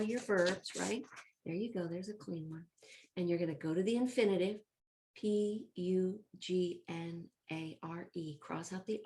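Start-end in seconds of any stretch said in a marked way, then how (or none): 5.2-8.4 slow letter-by-letter spelling; spelled out. Shown as a demonstration. Teacher spells the verb for pupils writing it, slowly.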